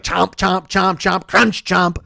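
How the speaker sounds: lightly voiced chomping dog; rhythmic mock munching; imitated dog chewing. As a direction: punch out each bite, with "crunch" hardest. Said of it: "He's just like".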